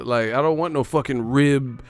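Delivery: ordinary delivery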